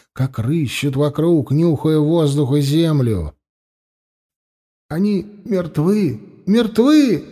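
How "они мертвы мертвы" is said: frightened repeating. Acted. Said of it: "мысленно повторял Толмач, словно убеждая сам себя".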